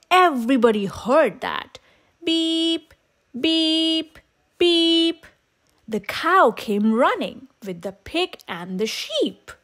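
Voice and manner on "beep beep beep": mild truck beeps. A narrator's reading. Truck beeping for help.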